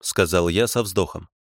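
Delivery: ordinary delivery, nothing marked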